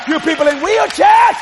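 loud, rising revival declaration; full-force preaching. Real, not acted. Preacher to people in wheelchairs, impassioned shouted preaching. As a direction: proclaim it, building to a shout.

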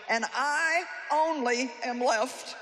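slowly, mournfully stressed; slow, heavy lament. Acted. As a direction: drag out each word in self-pity. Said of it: "and now watch it".

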